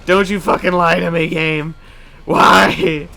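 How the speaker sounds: building to a high wail; gamer rage. Real, not acted. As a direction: rage at the game's lie. Game rage.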